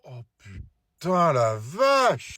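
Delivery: slow, drawn-out gasp of amazement; awestruck. Acted. Dad spotting mothers outside the school, slowly awestruck. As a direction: stretch the words out, stunned.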